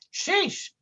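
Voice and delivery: very high, bumbling goofy voice; goofy cartoon sidekick. A narrator's reading. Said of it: "said Dummerton".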